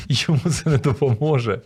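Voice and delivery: chuckling through the words; laughing. Real, not acted. Speaker amused by his own pilot example, laughing.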